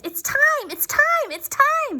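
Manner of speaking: high shouted cheer; excited shout. Acted. Toys in the bag shouting together, excited. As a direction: cry it out all together, thrilled. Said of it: "they all shouted".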